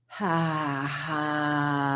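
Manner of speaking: long, steady sighed "haaa"; breath out slowly on an open "ha"; sounded exhale. Shown as a demonstration. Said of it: "exhale with sound".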